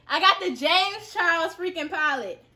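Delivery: high, loud and slow, laughing with joy; thrilled. Real, not acted. Announcing the dream palette to the camera, thrilled. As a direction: savor every word of the win.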